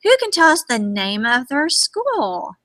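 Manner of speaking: ordinary talk, nothing marked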